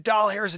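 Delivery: DOLL HAIRS in place of dollars; said as two words. Shown as a demonstration. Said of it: "didn't hear me say doll hairs instead of dollars".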